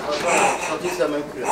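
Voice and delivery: low, soft, half-swallowed punchline; mumbled under a laugh. Retold or imitated. Blogger first mumbling her dad's joke, low and soft. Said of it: "mon père rajoute tout le temps".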